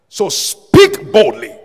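slow, high, forceful preaching shout; bold exhortation. Real, not acted. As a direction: belt out each word to rouse the congregation. Preacher exhorts congregation to boldness.

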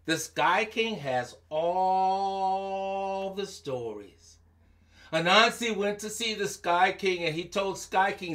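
usual speaking voice

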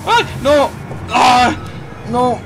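loud, very high cry of dismay; dismayed shriek. Real, not acted. Player shrieking at a failed move. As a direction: shriek as it goes wrong.

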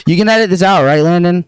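fast, high and loud; panicking gamer. Real, not acted. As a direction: rush it out in a frantic burst. After yelling into the mic.

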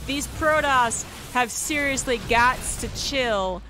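slow, drawn-out emphasis on each phrase; emphatic exclamation. Real, not acted. Caster telling the Protoss players to chill, emphatic. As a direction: stretch it out in mock exasperation.

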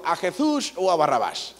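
put-on Castilian Spanish accent, lisped Jesús. Old-film Castilian official asking the crowd, Spanish accent. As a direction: redo it in a clear Castilian accent. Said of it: "¿Por qué hablas como españolito?".

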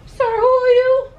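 very high, loud mock challenge; mock challenge shout. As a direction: imitate a startled yell at a stranger. Acts out challenge to dad.

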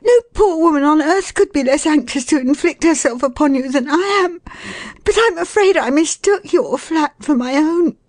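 very high, pleading woman's voice; piteous, flustered lady. Acted. Woman excusing herself to the flat's owner, piteous. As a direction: protest innocence in a high plaintive voice.

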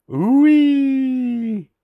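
loud, high, drawn-out ooh-wee; high sliding noise imitation; imitated monster wail. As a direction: let out a long high whistling wail. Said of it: "Owl added".

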